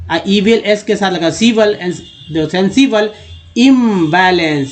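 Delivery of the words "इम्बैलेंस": "इम्बैलेंस" slowly, holding the first syllable; drawn-out word building. Shown as a demonstration. Teacher sounding out a word for learners, slow syllables. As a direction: stretch out "इम्बैलेंस" part by part.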